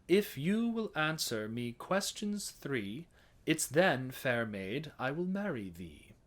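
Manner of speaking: sung with just a light touch of R; mid-Atlantic. Shown as a demonstration. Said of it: "right in the middle, the mid-Atlantic dialect".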